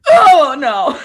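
loud, high comic wail; hammed-up panicked shout; mock horror. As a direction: cry out in exaggerated dread. Retells dismay at near love confession.

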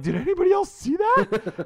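mock outrage at what nobody saw; incredulous high cry. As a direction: call out to the street, stunned. Asking bystanders in disbelief.

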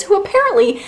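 high, mocking sarcasm; sarcastic. Real, not acted. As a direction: needle him with mock disbelief. Sarcastic jab at Oedipus.

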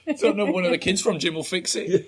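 light, laughing banter. Real, not acted. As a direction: giggle it out. Both laughing at joke.

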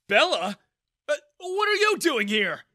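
loud, high startled question; Daniel surprised. A narrator's reading. Father startled by his daughter's arrival, surprised. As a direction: blurt it out, caught off guard. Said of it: "Daniel asked in surprise".

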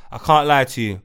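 ordinary delivery

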